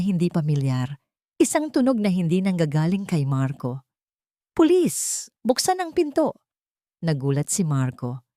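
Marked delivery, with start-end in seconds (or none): 4.5-6.3 police shout. A narrator's reading.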